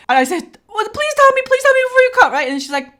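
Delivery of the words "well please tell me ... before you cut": high mock-desperate wail; desperate pleading. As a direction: beg to be warned, voice pitched way up. Retells pleading while in agony.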